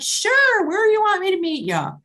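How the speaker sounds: gleeful instant yes; eager yes. Retold or imitated. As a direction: jump at the offer like a kid. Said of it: "I'm like".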